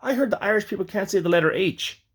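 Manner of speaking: jokey retold taunt; joking. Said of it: "as a joke, he's like".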